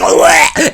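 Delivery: high strangled bleh; a comic choke of surprise; high comic gag as the probe hits; mock gag. [name] probed.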